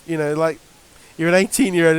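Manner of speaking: normal talking voice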